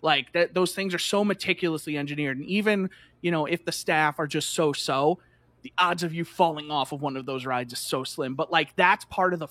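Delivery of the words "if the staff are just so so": ordinary talk, nothing marked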